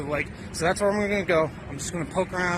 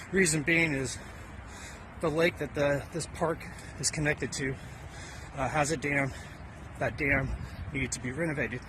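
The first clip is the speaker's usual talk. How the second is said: usual speaking voice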